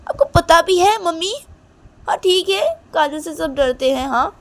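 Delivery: upset pleading. Acted. Reshma argues with mom about Kajal, high and distressed. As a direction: push hard, near tears. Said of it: "Reshma बोलती कि".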